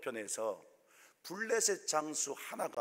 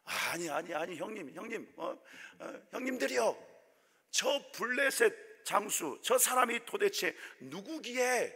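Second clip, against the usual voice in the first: lightly voiced David, raised in protest; mock indignant cry; 6 semitones higher than usual. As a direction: play young David, rising high in outrage. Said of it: "막 소리칩니다". (형들에게 따지는 다윗, 높아진 항변조)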